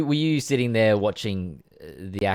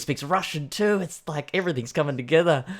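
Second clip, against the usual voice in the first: higher, louder gushing; mock delight; 6 semitones higher, 5 dB louder, faster than usual. Casting people finding their perfect Russian speaker, mock delight. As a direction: gush like a thrilled casting director.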